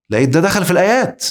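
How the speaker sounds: ordinary delivery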